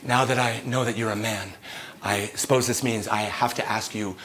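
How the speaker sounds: lightly voiced low, soft politician; a hint of a formal, murmured request; Donald Trump. President asks the man for consent, lightly voiced low murmur. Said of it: "Donald Trump? Is that you".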